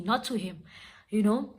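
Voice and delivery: normal talking voice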